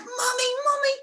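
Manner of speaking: put-on high-pitched child calling for Mummy; squealy mock child voice, words stretched; excited little boy. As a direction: imitate a wee boy bursting with news. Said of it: "teaching a wee boy in P two".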